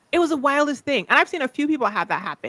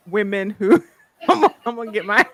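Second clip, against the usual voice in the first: talking through laughter; louder, cracking up mid-sentence; laughing; 4 dB louder than usual. Real, not acted. Losing it at her own adjectives, laughing.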